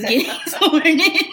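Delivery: breaking into laughter mid-phrase; laughing. Real, not acted. Describing eighty-year-old getting sworn in, laughing.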